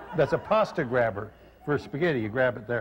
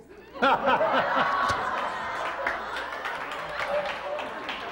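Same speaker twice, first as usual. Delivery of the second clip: high, breathless burst of laughter; real laughter. Real, not acted. Cohost laughing at the furrier joke, real laughter.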